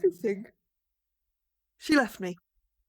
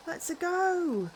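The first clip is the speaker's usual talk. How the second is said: slow, higher Italian-cartoon Let's-a go; mock Italian cheer; 5 semitones higher, slower than usual. Bus driver setting off, playful mock Italian cheer. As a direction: pull off with a hammed-up Italian plumber cheer. Driver sets off, Mario-style.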